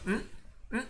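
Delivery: short closed-lip "mm"; quiet isolated hummed consonant; lone consonant hum. Shown as a demonstration. Teacher showing a consonant cannot stand alone, brief hummed "mm". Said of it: "Usted solamente va a decir".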